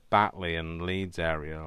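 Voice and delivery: normal talking voice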